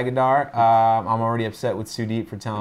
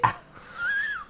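high-pitched burst of laughter; 7 dB quieter than usual. Real, not acted. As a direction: laugh out high at your own joke. Laugh after the joke.

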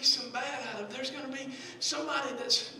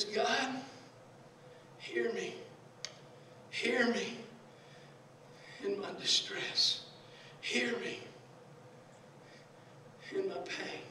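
hushed prayer; 7 dB quieter than usual. Acted. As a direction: pray it quietly, phrase by phrase. Reads David's prayer to God.

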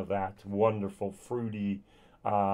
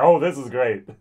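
delighted; 3 semitones higher, 10 dB louder than usual. Retold or imitated. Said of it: "She was like".

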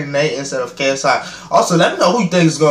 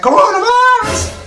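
squeaky, much higher, louder Coronavirus shout; [name] impression; 4 dB louder than usual. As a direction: squeal it the way [name] yelled it, voice cracking up high. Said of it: "Like [name] said".

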